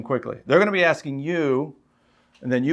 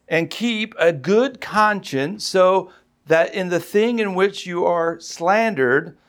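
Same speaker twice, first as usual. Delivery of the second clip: slow, measured, raised sermon delivery; emphatic preaching; 3 semitones higher, slower than usual. Real, not acted. Preacher reading scripture to the congregation, slow and emphatic. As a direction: proclaim it slowly, weighting each key word.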